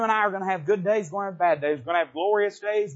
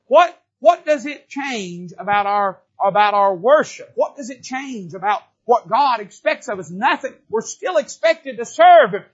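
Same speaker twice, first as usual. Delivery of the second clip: louder, higher preacher's build; rising preaching; 4 semitones higher, 7 dB louder than usual. Acted. Preacher pressing the church on what God expects. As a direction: drive the point home, building.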